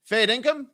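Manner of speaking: high, loud Aussie fair dinkum; Aussie slang demo. Shown as a demonstration. Explaining slang to viewers, high and loud. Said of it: "Fair dinkum means that it's true".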